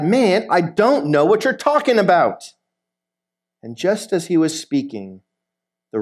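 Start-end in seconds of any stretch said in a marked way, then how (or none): 0.0-2.5 higher, exasperated protest; acted indignant denial; emphatic denial. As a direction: deny it hotly, as if reading aloud. Reads Peter's last heated denial.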